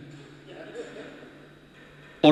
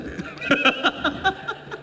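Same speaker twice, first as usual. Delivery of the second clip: high breathless laugh; 11 semitones higher, 6 dB quieter than usual. Real, not acted.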